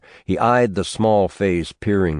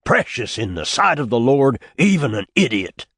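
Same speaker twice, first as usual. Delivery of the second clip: put-on fervent old preacher; 6 semitones higher than usual.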